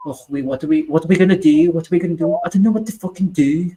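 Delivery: mock the rival fans with a Scouse accent. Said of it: "That's a good Scouse accent".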